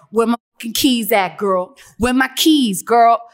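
loud imitation of a tipsy, demanding friend; Karina Correa. Imitating tipsy friend demanding her keys, loud and insistent. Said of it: "every time I would imitate her".

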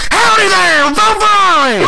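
loud sudden holler; scary yell. Acted. D A startles a woman on the street, sudden loud holler. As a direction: jump out and bellow at her. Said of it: "You just scared that poor woman to death".

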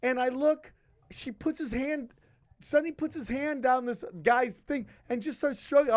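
ordinary delivery

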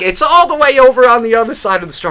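put-on high sweet old woman giving directions. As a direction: chirp it out like a helpful granny. Old woman voiced in story.

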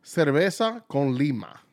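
Cerveza con Lima said the Spanish way, slow and careful; Spanish pronunciation. Shown as a demonstration. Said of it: "I'm gonna let you say the name".

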